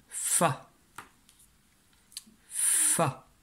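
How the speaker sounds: "fa" with a long, drawn-out f; syllable dictation. Shown as a demonstration. Teacher dictates a syllable to the pupil, drawn-out "fa". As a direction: dictate "fa", stretching the f into the vowel.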